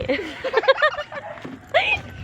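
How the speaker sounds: high-pitched burst of laughter. Real, not acted. Mother laughs at child.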